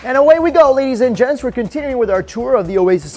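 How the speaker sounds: loud, high announcer kickoff; upbeat cruise director. Acted. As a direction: launch the tour like a showman. Announcer-style tour restart.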